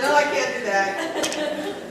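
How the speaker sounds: slow, laughing as she says no; laughing refusal. Retold or imitated. Her own refusal to the slides, retold laughing. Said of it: "I'm like".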